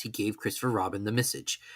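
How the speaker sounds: usual speaking voice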